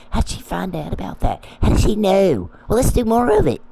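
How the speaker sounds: high, mocking hillbilly voice; mocking quote of the crew. Mocking the crew who spy on her, high sneering voice. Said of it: "I'm quoting you".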